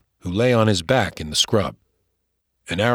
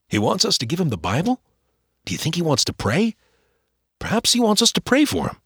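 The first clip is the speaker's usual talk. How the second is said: put-on higher, fast, boyish questions; eager boy; 8 semitones higher, faster than usual. Boy asks his father if the Indian wants to pray, high and quick. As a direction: rush the questions like an excited boy.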